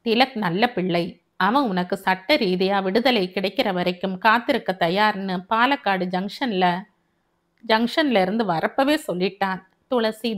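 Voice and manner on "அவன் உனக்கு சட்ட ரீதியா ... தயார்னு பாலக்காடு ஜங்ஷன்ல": plain reading voice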